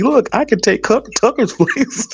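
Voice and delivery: high, smug mock bragging; mock boast. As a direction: parody someone angling for a job. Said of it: "He is like".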